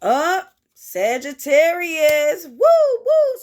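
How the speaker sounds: loud, high whooping; slow call then bright woo woo; excited cheer. Real, not acted. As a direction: cheer for the Sagittarius.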